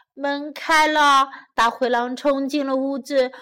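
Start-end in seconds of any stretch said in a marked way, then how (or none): none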